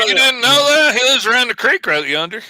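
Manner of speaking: exaggerated backwoods Southern twang; Southern accent. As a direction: play a high, loud Southern country neighbor. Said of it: "Mike was from the South".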